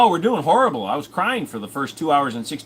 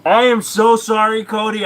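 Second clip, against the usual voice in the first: loud apology; 8 semitones higher, 7 dB louder than usual. Real, not acted.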